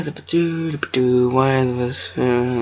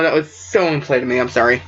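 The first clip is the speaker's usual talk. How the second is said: plain reading voice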